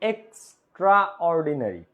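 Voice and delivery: এক্সট্রাঅর্ডিনারি slowly, syllable by syllable; slow syllable demo. Shown as a demonstration. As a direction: sound out এক্সট্রাঅর্ডিনারি. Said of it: "syllable demo". (এক্সট্রাঅর্ডিনারি ধীরে উচ্চারণ)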